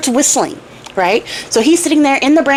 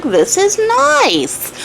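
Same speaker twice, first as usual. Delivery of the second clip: drawn-out, higher "This is nice."; savoring it slowly; 8 semitones higher, much slower than usual. Retold or imitated. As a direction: stretch "nice" out, impressed. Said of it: "So he's like".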